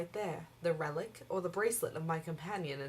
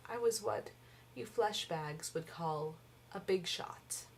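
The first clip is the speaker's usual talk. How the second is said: ordinary talk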